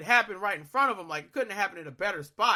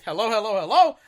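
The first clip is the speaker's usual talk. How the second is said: yelling hello; 6 dB louder than usual. Retold or imitated. Caller yells hello at the silent operator. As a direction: shout back down the phone at him. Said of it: "I'm screaming in the phone".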